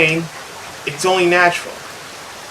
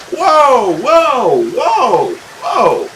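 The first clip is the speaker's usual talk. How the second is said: falling whoas, louder then fading; shocked whoa; 6 semitones higher, 4 dB louder, much slower than usual. Real, not acted. Streamer reacting to the harsh insult, falling shocked whoas. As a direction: back off in real shock.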